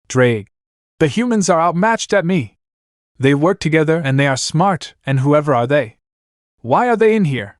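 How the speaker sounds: dragon voice, a touch angry; mildly angry demonic dragon; a little angry. A narrator's reading. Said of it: "He said in anger little bit".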